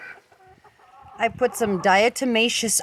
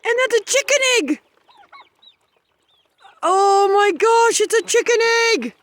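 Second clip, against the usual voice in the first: excited squeal; 12 semitones higher, 4 dB louder than usual. Real, not acted. First egg found in coop.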